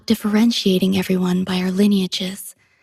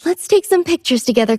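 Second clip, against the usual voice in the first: cheerful suggestion; 7 semitones higher, faster than usual. Acted. Cheerfully proposes photos to Commandant.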